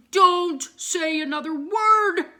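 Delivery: loud, slow cross snap; angry rooster. A narrator's reading. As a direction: cut them off, furious. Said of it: "said the rooster angrily".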